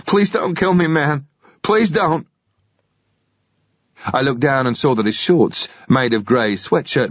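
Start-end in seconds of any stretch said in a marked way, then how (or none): 0.0-2.3 much higher, desperate pleading; high frightened plea; begging. Acted. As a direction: beg for his life. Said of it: "he begged".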